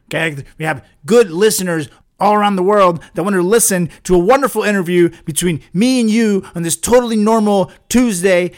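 loud, emphatic telling-off; exasperated, punchy rant; angry scolding. Acted. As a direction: berate a misbehaving guest. Host berates guest for ruining show.

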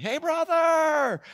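loud, high, drawn-out greeting; hearty greeting call. Acted. Preacher greeting a brother for a hug, big drawn-out holler. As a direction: holler it like a long-lost pal.

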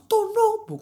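outraged yelp at the notebook; horrified outburst. Real, not acted. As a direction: shriek it in disgust. Horrified at the film choice.

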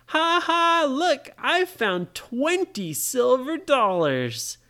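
high, loud ha-ha, then slow boasting; gloating excitement. Acted. Ryan shows off his silver dollars, gloating excitedly. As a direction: crow over the haul, drawing it out.

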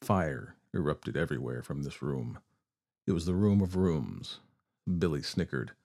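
plain reading voice

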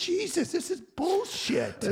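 high-pitched retold outburst, drawn out at the end; losing it. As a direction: replay an exasperated blow-up. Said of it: "I just lost it".

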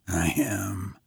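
low, deep and very soft. A narrator's reading. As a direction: answer from low in the chest, hushed. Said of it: "said the stranger in a low, deep voice".

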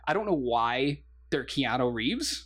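ordinary talk, nothing marked

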